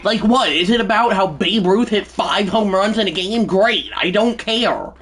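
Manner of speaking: high, loud, worked-up complaint; fed-up, high-pitched griping; exasperated rant. Real, not acted. Ranting about game notifications.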